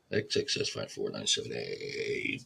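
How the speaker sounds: muttered readout. Real, not acted. Reading runoff numbers under breath.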